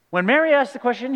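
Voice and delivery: normal talking voice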